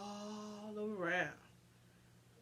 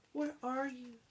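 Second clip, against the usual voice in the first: plaintive call; 5 semitones higher, 4 dB quieter than usual. Acted. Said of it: "he called, plaintive cry".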